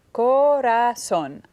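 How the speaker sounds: "Corazón" very slowly as co, ra, zón; syllable by syllable. Shown as a demonstration. Student counting syllables aloud, syllable by syllable. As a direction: split "Corazón" into three separate beats. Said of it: "I hear three syllables".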